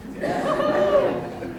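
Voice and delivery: a burst of high laughter. Real, not acted. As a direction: laugh high at your own joke. Laughs at his own joke.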